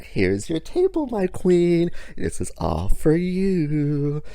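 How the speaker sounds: high, slow, royal welcome; bow to her like a queen's servant; gracious server. Retold or imitated. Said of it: "I kinda gestured like".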